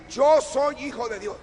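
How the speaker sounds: emphatic declaration. Shown as a demonstration. Preacher shows the congregation how to declare it, loud and emphatic.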